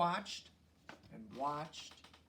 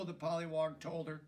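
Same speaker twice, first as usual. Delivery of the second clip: usual speaking voice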